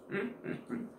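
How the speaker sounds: breathy high mm; whispered savoring hum. Real, not acted. Tasting food, eating.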